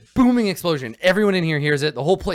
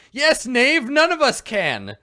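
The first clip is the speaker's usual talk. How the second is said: high, loud, exasperated retort; shouting knight leader; 10 semitones higher, 5 dB louder, slower than usual. Acted. Sir Bluto answering his knight in the dark, shouting. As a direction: bellow back across the dark, drawing out the end. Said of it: "And then Sir Bluto's like".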